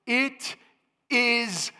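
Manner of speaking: loud, high proclamation from heaven; cried out. Acted. Voice from heaven crying out to all the earth, loud and high. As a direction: ring it out like a voice from the temple. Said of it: "it cries out".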